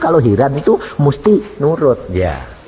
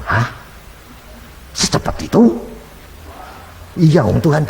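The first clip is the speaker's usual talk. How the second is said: breathless, unvoiced, high disbelief; stunned husband in a near-whisper; shocked question; 3 semitones higher than usual. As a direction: gasp it out in disbelief. Husband hears wife will die.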